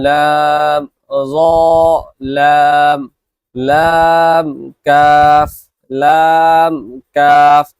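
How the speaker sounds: each letter name drawn out slowly and loud; letter drill. Shown as a demonstration. Teacher models letter pairs for students to repeat, drawn out. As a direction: sing out জিম and দাল long and clear. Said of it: "আমি দুবার পড়ব".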